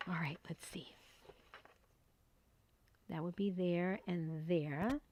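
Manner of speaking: soft whispered all right, let's see; hushed whisper to herself. Real, not acted. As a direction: murmur it to yourself while concentrating. Focusing quietly on measuring.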